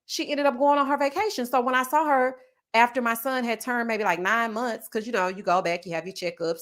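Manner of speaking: ordinary delivery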